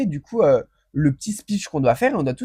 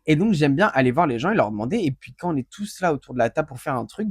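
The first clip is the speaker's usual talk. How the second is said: ordinary talk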